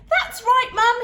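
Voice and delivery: put-on high loud small-boy voice; little boy agreeing. A narrator's reading. Youngest son agreeing, high boyish voice. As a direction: pipe up eagerly. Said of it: "he agreed".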